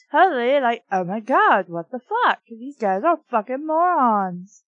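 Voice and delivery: loud incredulous scoff at the book; ridiculing the story's wording; mocking outburst. Real, not acted. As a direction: rant at the dialogue, loud. Reader mocks the book's line.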